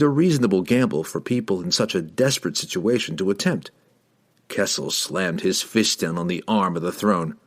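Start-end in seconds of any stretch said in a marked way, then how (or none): none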